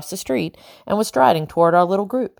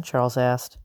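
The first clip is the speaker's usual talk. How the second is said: ordinary delivery, nothing marked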